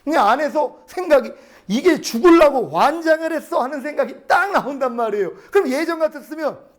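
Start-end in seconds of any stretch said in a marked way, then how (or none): 1.7-3.6 higher-pitched retold road-rage thought; angry outburst. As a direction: curse the driver in mock outrage. Retold angry thought at cutting-in taxi.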